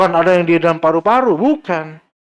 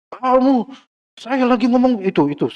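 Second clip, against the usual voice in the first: put-on offended old man; 6 semitones higher than usual. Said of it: "Tersinggung ini orang tua".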